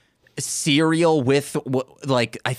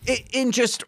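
ordinary delivery

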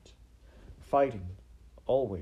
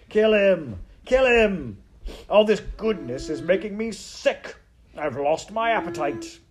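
high, furious heckling; shouted; 10 semitones higher, 10 dB louder than usual. Acted. Rat demands a kill, sickened by goodness, shouted. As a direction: yell it in loud disgust. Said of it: "shouted Botticelli again".

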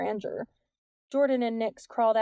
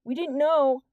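loud, raised-pitch excuse; calling out; 5 semitones higher, 6 dB louder, slower than usual. A narrator's reading. Boy excusing himself to a distant officer, calling out. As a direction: call back so they can hear you below. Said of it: "Jordan called".

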